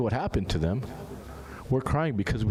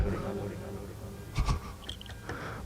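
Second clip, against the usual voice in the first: a quiet breathy chuckle; brief hushed laugh to himself; soft breathy laugh; 3 semitones lower, 9 dB quieter than usual. Real, not acted. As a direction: laugh softly under the breath. Laughs mid-sermon.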